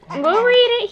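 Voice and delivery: high sing-song repeat; high playful echo. Acted. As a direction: echo it back high to the baby.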